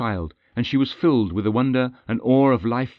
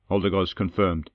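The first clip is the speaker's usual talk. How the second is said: plain reading voice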